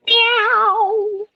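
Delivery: high cat meow. Acted. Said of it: "That was cat spirit talk".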